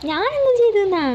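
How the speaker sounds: high, loud bewildered cry; desperate protest. A narrator's reading. Son dodging the broom, protesting loudly. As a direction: protest your innocence.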